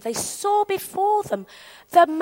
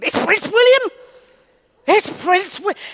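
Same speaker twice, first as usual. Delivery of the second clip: high, urgent squeal of discovery; excited recognition; 4 semitones higher than usual. Acted. Woman spotting a prince nearby, suddenly thrilled. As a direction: burst out as it hits you.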